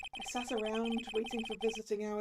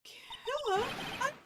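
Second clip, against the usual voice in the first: put-on young girl voice; 13 semitones higher, slower than usual. Girl character denies it.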